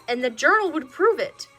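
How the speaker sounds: ordinary delivery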